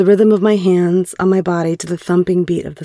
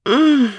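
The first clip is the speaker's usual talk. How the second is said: higher-pitched closed-mouth "mm"; acted moan; 9 semitones higher than usual. Woman answering her partner with her mouth full, acted moan. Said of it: "I moaned an".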